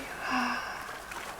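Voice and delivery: soft airy sigh, all breath; empty the lungs in one soft sigh; full exhale sigh. Shown as a demonstration. Teacher leading a breath, exhaling fully in a sigh. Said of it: "exhaling fully".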